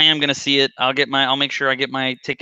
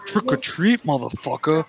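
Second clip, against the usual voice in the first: [name] line. Pretend. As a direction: ham up a slow rapper taunt. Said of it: "[name] in the last one".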